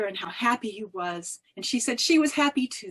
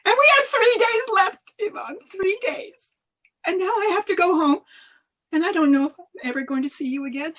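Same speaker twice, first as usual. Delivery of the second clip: higher, fast, pleading farewell; urgent farewell; 7 semitones higher than usual. A narrator's reading. Yelena telling her husband she must leave, urgent and higher. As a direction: tell him time is short, anxiously.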